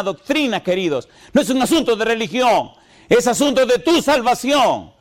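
tono agudo y enfático de predicador; prédica enérgica. Real, not acted. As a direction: predica con fervor, subiendo mucho la voz de tono. Es un grito lo que estoy haciendo de mi corazón. (prédica fervorosa en español)

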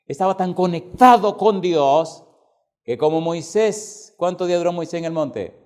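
preaching loud and building; predicación enérgica. Acted. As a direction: preach it out to the congregation. Predica con fuerza. (predicando con fuerza a la congregación)

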